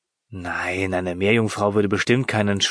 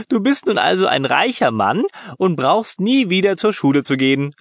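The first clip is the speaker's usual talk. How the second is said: higher, teasing through giggles; giggling; 7 semitones higher than usual. A narrator's reading. Lena giggling and teasing her brother. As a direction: tease him while still giggling. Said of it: "gluckste sie".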